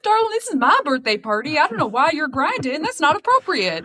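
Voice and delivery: a Southern belle shooing off a pest. Pretend. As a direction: lift it way up, sugary and polite.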